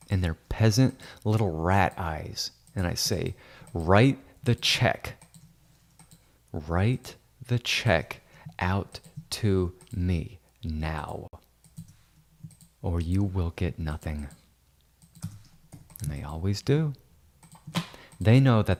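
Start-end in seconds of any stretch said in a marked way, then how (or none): 3.7-14.3 very slow, word-by-word demand, sinking lower; slow mock ultimatum. Editor demands realtors write the check, slow menace. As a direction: space out each word as a pretend threat.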